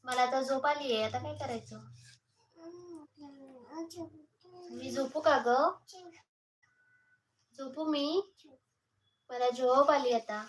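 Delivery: soft, drowsy voicing of the sleepy little one; sleepy baby voice. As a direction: mumble it like a tired toddler.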